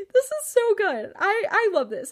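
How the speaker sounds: high, loud laughing through words; delighted laughing. Real, not acted. Laughing rereading diary.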